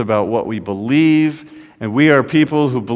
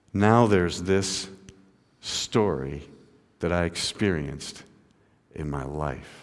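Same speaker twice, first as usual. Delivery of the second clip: hushed reflection; 7 semitones lower, 10 dB quieter than usual. Real, not acted. Preacher confiding his own experience, hushed. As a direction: let it sink almost to nothing.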